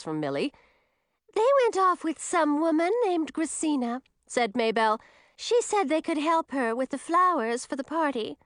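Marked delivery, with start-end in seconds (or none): 1.3-4.0 high-pitched character voice; Maybelle, young character voice. Acted. 5.4-8.4 high-pitched character voice; Maybelle, young character voice. Acted.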